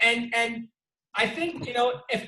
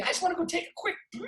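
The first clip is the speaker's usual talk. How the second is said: quick mock line; 9 semitones higher, 4 dB quieter, much faster than usual. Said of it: "he said it twice".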